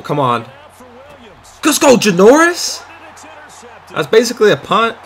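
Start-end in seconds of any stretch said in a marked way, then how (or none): 1.7-5.0 high, loud celebration shout; excited cheer. Real, not acted. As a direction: cheer the player on. Cheering a big play.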